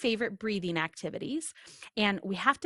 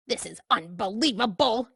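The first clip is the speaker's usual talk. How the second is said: ordinary delivery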